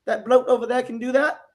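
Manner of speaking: high-pitched bad British accent drifting into Australian; hammed-up attempt at a British bloke's accent; butchered British accent. Host mimics coach Slick's UK fans, butchered British accent. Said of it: "I'm gonna butcher this act-, Slick's accent".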